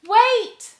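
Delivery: very high, loud plea; begging. Acted. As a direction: call her back urgently. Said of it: "begged the girl".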